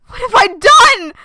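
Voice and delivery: high loud despairing cry; anguished cry. Acted. Character cries out after cutting herself. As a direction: cry out in horror. Repeated much louder.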